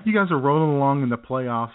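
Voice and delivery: normal talking voice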